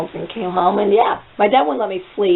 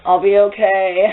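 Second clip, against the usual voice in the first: slow, drawn-out reply; mock-pained, slow reassurance. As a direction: stretch it out like someone hurting but coping. Pained reply after her fall.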